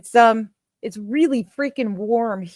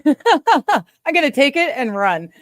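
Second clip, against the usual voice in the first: laughing; 6 semitones higher, 6 dB louder, slower than usual. Real, not acted. Laughs, relieved it works.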